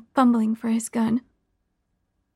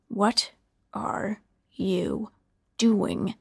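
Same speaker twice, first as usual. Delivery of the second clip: lower, very slow hushed question, word by word; slow menacing repeat; 3 semitones lower, much slower than usual. A narrator's reading. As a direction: drag each word out, half whispered. Killer repeats, drawn out.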